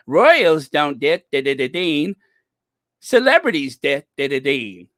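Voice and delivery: loud, garbled mock New Zealand twang; Dan Wooten. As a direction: blurt it in an upset New Zealand accent, mangling words. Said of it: "that New Zealand twang kinda comes out".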